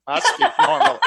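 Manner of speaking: laughing through the words; amused, breaking into laughter mid-line. Real, not acted. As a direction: laugh your way through the joke. Joking about who has accent.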